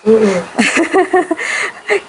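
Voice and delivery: low-key, drawn-out chuckle; long chuckle at an answer; laugh. Real, not acted. Teacher reacting to a participant's answer, long chuckle.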